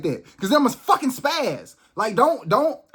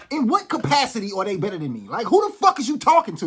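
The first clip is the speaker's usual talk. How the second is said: usual speaking voice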